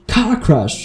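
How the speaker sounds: roared. A narrator's reading. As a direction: roar it in outrage. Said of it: "roared Hagrid".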